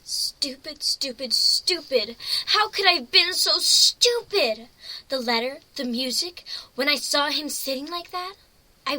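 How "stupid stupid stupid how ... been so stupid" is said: rising, louder self-scolding; self-reproach. Acted. Pony scolding herself after being fooled, angry. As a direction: beat yourself up, building.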